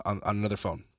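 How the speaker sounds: ordinary talk, nothing marked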